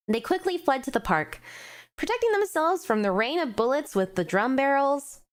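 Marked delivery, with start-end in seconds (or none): none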